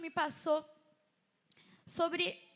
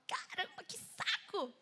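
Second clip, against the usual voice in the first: mock exasperation; 5 semitones higher, 6 dB quieter, slower than usual. Acts out her past annoyance.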